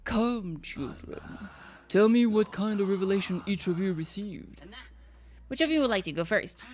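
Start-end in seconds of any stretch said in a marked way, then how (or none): 0.0-4.6 a lightly voiced, slow grand prophet; solemn prophet. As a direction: intone it like a self-important cult leader. Voices the Bang Boo prophet.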